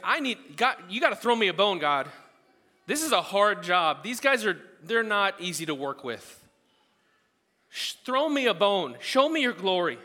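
lightly voiced Moses begging God; upset Moses. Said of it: "he was upset".

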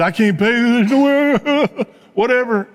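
high, slow, pleading plea from the debtor; begging debtor. Retold or imitated. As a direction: beg for mercy, drawn out and high. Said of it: "And the guy begs for mercy".

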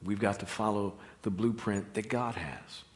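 normal talking voice

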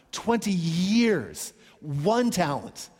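"Twenty years, one talent" spelled out very slowly; preacher stressing the size of the sum; slow emphatic. Shown as a demonstration. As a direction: let each word land, slowly. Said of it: "stressing scale of debt".

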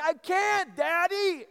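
put-on high, loud tearful kid wail; mock crying child. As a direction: imitate a child crying to Daddy. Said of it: "she starts crying".

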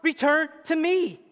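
high, loud frustrated outcry; God pleading loudly. Retold or imitated. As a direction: cry out in frustration for attention. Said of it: "He says".

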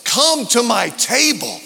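preached invitation. Acted. Pastor voicing Jesus' invitation to the church, proclaimed loud. Preached line, then quiet echo.

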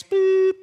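high, sharp mock bell beep. Telling how class ended: high imitated bell beep.

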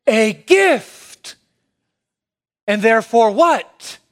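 getting excited. Real, not acted. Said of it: "I'm getting excited".

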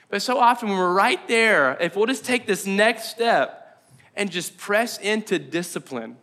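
ordinary talk, nothing marked

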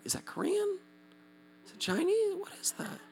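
high, hushed puzzlement; whisper-soft confused questioning; baffled aside. Retold or imitated. To himself while distracted from worship, hushed bafflement. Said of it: "I'm so distracted".

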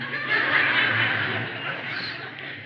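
soft airy chuckle; amused laugh at his own joke; quiet breathy laugh. Real, not acted. Laughs at his spider threat.

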